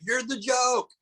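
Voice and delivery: high, mock-yelled retort; taunting comeback. Comic firing back at the huge heckler, high taunting call.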